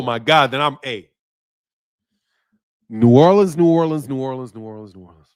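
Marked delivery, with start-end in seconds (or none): 2.9-5.3 trailing down into near silence; fading echo. Pretend. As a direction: repeat it like an echo dying away. Chants city name, fading like an echo.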